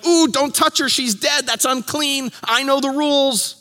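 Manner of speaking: high, anxious father pleading; a worried dad blurting out his fears; panicked father. Retold or imitated. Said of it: "Jairus may be going".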